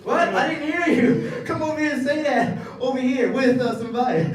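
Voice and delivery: high, loud, laughing dare; mock challenge. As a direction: call him out, mock tough.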